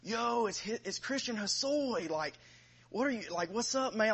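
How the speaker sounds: younger inmates. Retold or imitated. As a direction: imitate excited young inmates greeting him. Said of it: "guys that were younger than him would be like".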